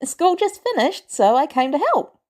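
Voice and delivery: put-on a keen teenager explaining himself; youthful boy.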